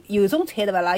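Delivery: usual speaking voice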